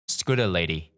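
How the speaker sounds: plain reading voice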